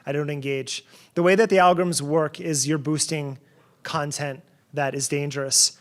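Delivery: ordinary talk, nothing marked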